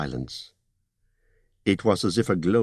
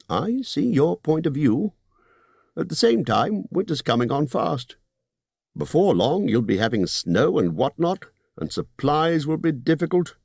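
高めの声で念を押すホビット; 利益の取り分を強調する商談口調; Bilbo's voice; 7 semitones higher than usual. Acted.

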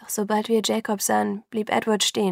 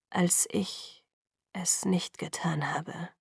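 hushed, rough and fervent; 2 semitones lower, 7 dB quieter, much slower than usual. A narrator's reading. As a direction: raw intensity, barely voiced. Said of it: "Seine Stimme war rau und voller Inbrunst".

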